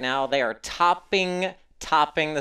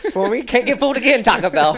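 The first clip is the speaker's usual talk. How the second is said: playful mock proverb; 7 semitones higher, 7 dB louder, faster than usual.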